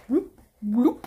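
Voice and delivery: playful bubbly bloop sounds; jellyfish impression. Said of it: "That's my impression of a jellyfish".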